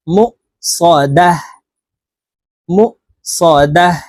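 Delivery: দাহ closed on a vowelless h; waqf ha ending. Shown as a demonstration. Teacher showing the waqf reading, দাহ ending in h. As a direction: stop on দাহ with an audible h, no tun. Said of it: "হার উচ্চারণ করব এবং হাকে সাকিন করে ফেলবো".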